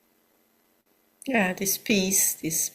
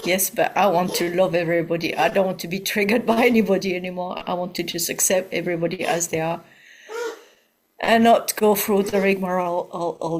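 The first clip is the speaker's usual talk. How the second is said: quick, insistent objection; fast, pressing plea from within; inner objecting voice; 6 dB louder, faster than usual. Acted. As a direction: voice the part that protests, in a rush. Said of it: "there is a voice that goes".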